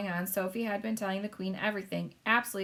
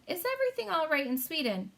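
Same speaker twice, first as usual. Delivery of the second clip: high queen voice; polite royal phone call; 8 semitones higher than usual. Acted. Queen checking on Sweden by phone, high and polite.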